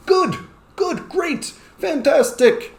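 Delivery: very high, squeaky word drill; playful drill calls. Shown as a demonstration. Teacher mixes in earlier words, very high and lively. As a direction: squeak out the words to test the class.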